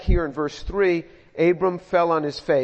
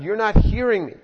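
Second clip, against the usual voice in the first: louder and higher, pressing the point; insistent repeat; 4 semitones higher, 6 dB louder than usual. Retold or imitated. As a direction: say it again so he finally hears. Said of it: "So God says it again".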